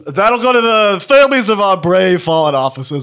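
lightly hammed-up earnest cop; touch of official pride in a put-on officer voice; police voice. Shown as a demonstration. Fake cop praises fallen officers to old lady, police voice. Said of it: "Say it in your police voice".

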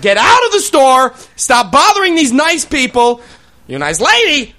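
high, loud slow yell. Retold or imitated. Chaperone yelling kids out of the store, loud. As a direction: shout them out of the store. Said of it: "only 'cause I'm yelling".

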